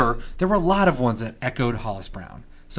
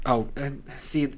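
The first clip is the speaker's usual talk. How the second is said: normal talking voice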